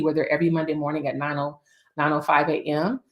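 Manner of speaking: ordinary talk, nothing marked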